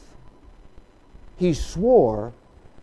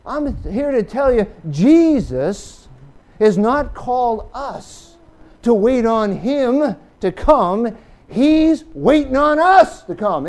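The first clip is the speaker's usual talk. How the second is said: louder, building sermon proclamation; full-force preaching; 5 semitones higher, 8 dB louder, slower than usual. Acted. Preacher stresses Jesus's oath to the congregation, loud preaching. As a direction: drive it home to the congregation.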